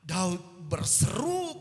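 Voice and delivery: high, loud, urgent sermon voice; full-force preaching. Real, not acted. Preacher to congregation on life's hard struggles, full-force preaching. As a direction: proclaim it at the top of the voice.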